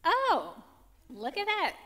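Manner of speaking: high, sing-song mock surprise; breezy non-admission. Sister shown she was wrong, breezy and unbothered. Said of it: "her response is always very simple".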